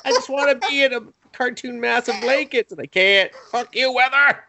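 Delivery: high-pitched comic whining at the heat; mock exasperated rant. Acts out friend cursing the heat.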